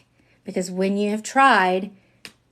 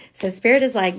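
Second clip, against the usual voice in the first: ordinary talk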